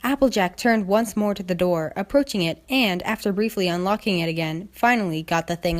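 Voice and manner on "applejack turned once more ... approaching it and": usual speaking voice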